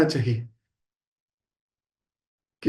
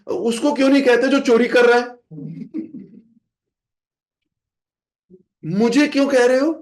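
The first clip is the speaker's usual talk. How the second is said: a king objecting to being scolded instead of the thief; indignant protest; 7 semitones higher than usual. Retold or imitated. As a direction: protest the unfair blame hotly. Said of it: "अब पृथु महाराज बोले".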